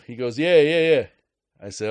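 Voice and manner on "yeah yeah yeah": loud, higher Boston "yeah, yeah, yeah"; gloating Boston cashier, louder; Boston accent. Retold or imitated. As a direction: hammer it home like a cocky Boston kid. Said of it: "Boston accent".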